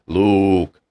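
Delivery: lightly voiced Darth Vader through a fan; a hint of the masked villain. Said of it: "it's like I'm talking into a fan".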